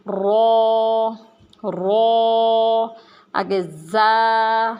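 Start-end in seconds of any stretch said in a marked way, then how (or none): 0.1-2.9 رو said thick and full, lips rounded; heavy rounded رو. Shown as a demonstration. Teacher showing the heavy letter رو to children. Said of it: "موٹا کر کے پڑھنا ہے".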